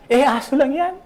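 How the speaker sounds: dismissive imitation of a doubter; scoffing skeptic. Preacher mimics someone dismissing the dog, high mock scoff. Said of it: "Sabi niya".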